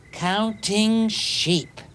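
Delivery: Counting sheep stretched out slowly, each word savoured; drawn-out announcement. Acted. Sleep expert slowly unveiling his most famous method. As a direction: unveil it slowly like a grand reveal.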